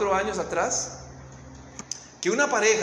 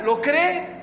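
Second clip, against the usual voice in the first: higher, pressing, slow question; fervent preaching; 5 semitones higher than usual. Real, not acted. Preacher urges the congregation about faith and trust, fervent. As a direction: urge them to trust.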